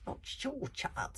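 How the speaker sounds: high, slow, whispered squeak. Acted. Librarian correcting the chief, high whispered squeak. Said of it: "squeaked the librarian".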